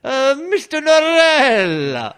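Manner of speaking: very high, slow, loud fawning squeal. Retold or imitated. Drawlight fawning over Mister Norrell, high slow squeal. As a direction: gush over your idol breathlessly.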